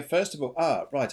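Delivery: normal talking voice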